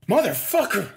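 high-pitched outburst of rage; furious curse. Acted. Slams fist on seeing family captive.

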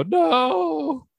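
high, drawn-out "no"; mock disaster cry. Comic at every disaster in his life, high mock wail. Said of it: "throw my arms up and go".

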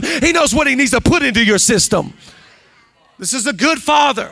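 loud, rising preacher's cadence; full-force preaching. Acted. Pastor preaching to the church, loud and building. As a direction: preach it out to the congregation.

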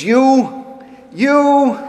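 high, loud, choked-up "You, you"; emotional outburst. Retold or imitated. Volunteer overwhelmed by his thanks, high loud outburst. Susie overcome, grabbing his cheeks.

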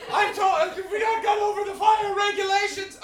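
high mock-indignant shout in a put-on accent; hammed-up official-sounding Brit; mock British shout. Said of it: "most U K thing".

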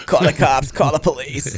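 soft mock panic in character; movie character impression. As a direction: riff on the movie line. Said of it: "the guy from Independence Day".

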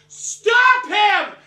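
very high, loud full-out yell; desperate scream. Acted. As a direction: scream after a fleeing thief. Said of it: "am fucking screaming after this guy".

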